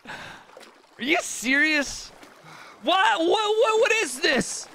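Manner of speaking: high, incredulous whats; can't believe what just happened; real shock, voice shooting up; stunned disbelief. Real, not acted. Disbelief at drowning in game.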